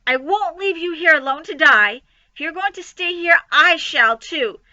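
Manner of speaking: high, loud defiant pig; loud protest. Acted. Wilbur refusing to leave Charlotte, high and loud. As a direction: refuse to leave her, loudly.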